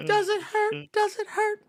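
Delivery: high-pitched, taunting imitation; mocking movie-villain quote. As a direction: tease it in a squeaky high voice. Mimicking movie line, high put-on voice.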